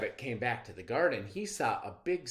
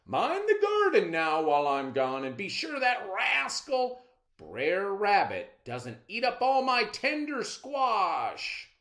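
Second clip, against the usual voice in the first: higher and louder, firm instructions; bossy farmer father; 11 semitones higher, 8 dB louder than usual. Acted. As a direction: lay down the law to your daughter. Mister Man instructing daughter.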